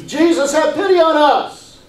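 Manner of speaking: high, loud imitated cry for pity; yelled plea. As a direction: call out like a desperate beggar. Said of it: "yelling out in a loud voice".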